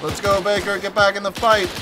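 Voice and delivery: high, loud command to push; rally him back into the fight; rallying shout. Acted. Rallying a teammate in combat.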